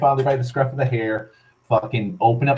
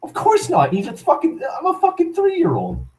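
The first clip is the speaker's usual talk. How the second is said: much higher, exasperated disbelief; incredulous outburst. Real, not acted. Teller scoffing at the cop's claim, much higher incredulous outburst. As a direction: fire it back in sheer disbelief.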